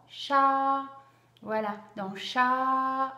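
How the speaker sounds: "chat" loud and slow, vowel held long; stretched vowel. Shown as a demonstration. Teacher showing parents the A sound in a word, stretched vowel. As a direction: draw out the "a" in "chat".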